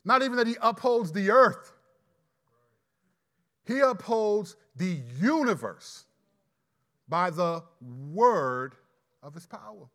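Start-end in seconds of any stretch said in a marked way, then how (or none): 3.7-9.9 slow right down on "by the word"; slow stress. Shown as a demonstration. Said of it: "This is personal providence".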